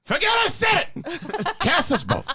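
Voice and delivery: Pacino rant. Acted. Pacino rant bit continues.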